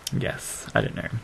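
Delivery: muttered under breath, unvoiced yes; half-whispered aside to self; quiet aside. Real, not acted. Quiet aside after a move.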